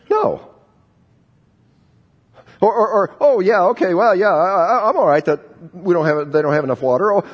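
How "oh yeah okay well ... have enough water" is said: halting, hammed-up acceptance, sinking lower at the end; mock acceptance. Preacher acting out a listener accepting suffering, mock agreement. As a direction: imitate someone too easily satisfied. Mock reply of someone wrongly satisfied.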